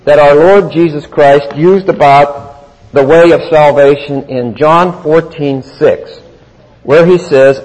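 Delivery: ordinary talk, nothing marked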